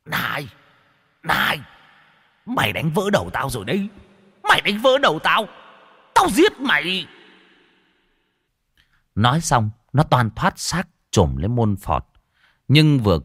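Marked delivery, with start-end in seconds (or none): 0.1-7.1 high, howling ghost screaming in pain. A narrator's reading. Wounded ghost howls threats at Môn Phọt. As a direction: wail then threaten, climbing higher. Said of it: "mà gào lên".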